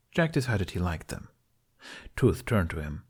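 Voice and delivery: ordinary delivery, nothing marked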